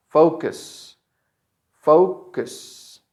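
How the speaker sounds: "Focus" with long strong fo, light cus; stress on fo. Shown as a demonstration. Teacher shows where the stress is, stressed fo. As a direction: lean on FO in "Focus", then a short cus. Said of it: "The stress is in fo".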